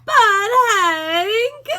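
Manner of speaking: a timid pup whimpering to his boss; scared Drover. A narrator's reading.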